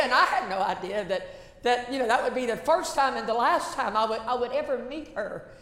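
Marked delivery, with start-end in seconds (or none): none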